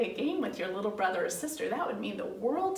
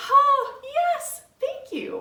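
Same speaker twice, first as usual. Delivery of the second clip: high-pitched, grateful burst of relief; delighted mom; 7 dB louder, much slower than usual. Acted. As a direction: act out a thrilled mom, starting loud. Mom delighted by offer of help.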